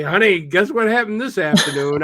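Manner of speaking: high, loud pretend homecoming news; mock excited announcement. Host imagining telling honey about the afternoon, mock excited.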